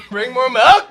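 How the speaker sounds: laughing shout. Real, not acted. Yelling for milk, laughing.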